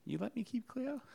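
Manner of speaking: very high, soft little question; tiny high-pitched plea; small pleading voice. Pretend. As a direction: squeak it out hopefully and softly. Mock-pleading about keeping the dog.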